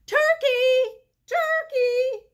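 high, loud honeyed calling; sweetest voice. Acted. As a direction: coax the turkey sweetly from afar. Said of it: "called in his sweetest voice".

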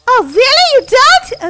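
high, loud questions; surprised relief. Acted. Kid learning it was a joke, relieved. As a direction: burst out in happy disbelief.

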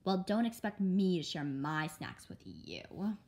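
plain reading voice

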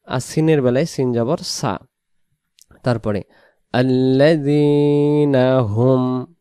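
normal talking voice

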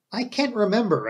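a touch of searching the memory; slow, hesitant. A narrator's reading. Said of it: "I said slowly".

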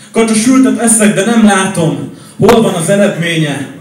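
loud, high slam-poem climax; emphatic climax. Acted. (hangos, indulatos szavalás)

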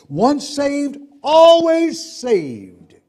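high, loud, drawn-out doctrinal slogan; proclaimed slogan. Acted. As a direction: belt it out and stretch the last words. Mock-proclaims a doctrine he disputes.

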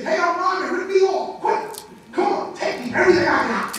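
loud, high, eager invitation to be robbed; eager shout. Acted. Enlightened man gleefully inviting thieves, loud and eager. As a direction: call out gleefully, begging to be taken. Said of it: "you'd say".

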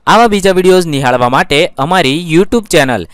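loud, bright-voiced thank-you and subscribe pitch; energetic outro announcer. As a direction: sell the subscribe call like a YouTube host.

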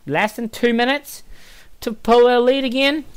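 high, delighted disbelief; excited. Real, not acted. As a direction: marvel at the quick goal. Delighted at quick lead.

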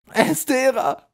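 very high, loud cry; desperate scream. A narrator's reading. Tortured troll gives up the name, screaming. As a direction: shriek the name in utter distress. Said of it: "schrie der Troll in höchster Not".

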